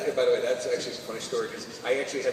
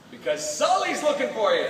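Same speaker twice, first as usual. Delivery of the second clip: higher-pitched wake-up holler; rousing call; 10 semitones higher, 3 dB louder than usual. Acted. Game actor recording a fan's morning alarm, urgent call. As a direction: call out to rouse a sleeper.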